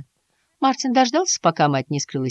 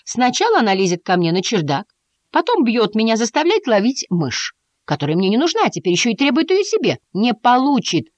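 возмущённая тирада повышенным голосом; высоко, с нарастающим негодованием; рассерженно; 7 semitones higher than usual. A narrator's reading. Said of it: "Вдруг он очень рассердился". (сердито и обиженно)

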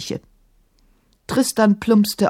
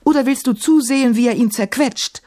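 higher-pitched, agitated outburst; urgent cry; 6 semitones higher than usual. A narrator's reading. Emma pushing Maimun to rescue Kafur, crying out. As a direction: press the question in a raised voice. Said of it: "schrie sie".

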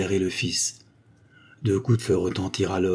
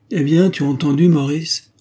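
impatient prompt; 7 semitones higher, 7 dB louder than usual. A narrator's reading. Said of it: "dit le baron".

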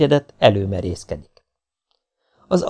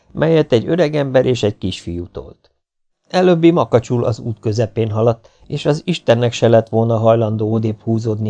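ordinary delivery

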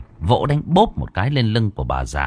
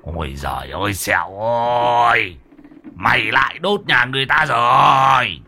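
wailing outcry; 2 semitones higher than usual. A narrator's reading. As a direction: bawl in despair, stretching the last words. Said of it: "thầy Muôn Phọt mới gào lên".

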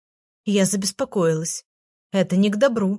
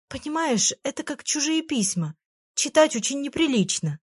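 sighing; 6 semitones higher than usual. A narrator's reading. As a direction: sigh it out gently. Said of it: "вздохнула я".

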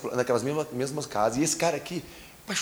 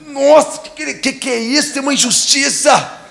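indignant outburst; 9 semitones higher, 8 dB louder than usual. Retold or imitated. Angry neighbor protests injustice.

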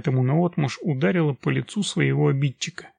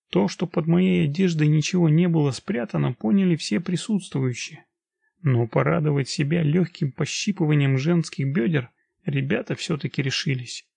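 ordinary delivery

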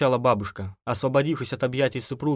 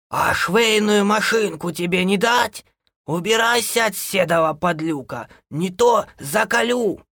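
high, loud scolding from the yard; angry granny; 10 semitones higher, 4 dB louder, slower than usual. A narrator's reading. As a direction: threaten loudly in a high old woman's voice. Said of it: "Со двора послышался голос бабушки". (бабушка гневно кричит, высоко)

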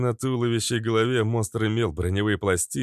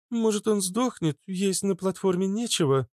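put-on higher, musing young woman; 12 semitones higher than usual. A narrator's reading. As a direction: toss out a guess. Blondie guesses.

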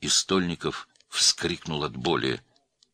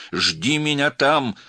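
slow, high, loud command; shouted order; 10 semitones higher, 7 dB louder, much slower than usual. A narrator's reading. Man ordering girl to wait, shouting slowly. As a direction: stretch it out in an emphatic shout. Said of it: "закричал он в трубку".

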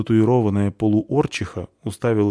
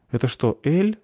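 higher-pitched, plaintive question; 7 semitones higher than usual. A narrator's reading. Girl sees the mugs of ale, plaintive. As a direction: ask it in a small pleading tone. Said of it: "жалобно спросила девушка".

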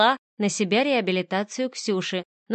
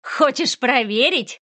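higher, louder, slow mocking challenge; taunting; 5 semitones higher, 5 dB louder, slower than usual. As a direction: dare her with a cruel grin. Boss taunts the heroine. (издевательски)

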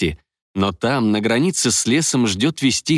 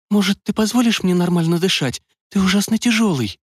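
put-on higher, lighter girl's voice; a young woman, a bit annoyed; 9 semitones higher than usual. A narrator's reading. Young woman speaks in story.

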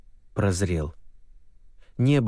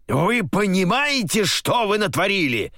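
furious general; 11 semitones higher than usual. Acted. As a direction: roar at him in rage. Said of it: "Надрывался он так громогласно".

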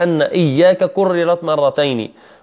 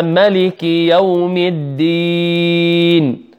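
slow chanted verse for students to repeat; measured tajweed chant; model recitation; 3 semitones higher, much slower than usual. Shown as a demonstration. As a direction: recite slowly, holding the final long vowel. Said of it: "أقرأ الآن وتعيدون معي".

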